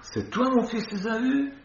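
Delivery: high incredulous rise; doubting question. Acted. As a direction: squeak out the doubt.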